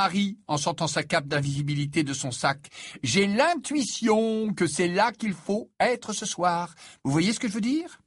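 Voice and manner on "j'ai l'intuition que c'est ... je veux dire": high, slow, stretched-out conviction; elated certainty. A narrator's reading. Harry explains his intuition to friends, elated. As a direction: draw it out as if inspired.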